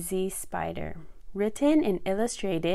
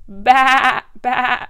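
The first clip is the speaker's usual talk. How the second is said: loud, higher-pitched baa; sheep bleat; 4 semitones higher, 12 dB louder than usual. A narrator's reading. Storyteller as the sheep calling to the spider, loud bleating. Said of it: "bleated the sheep".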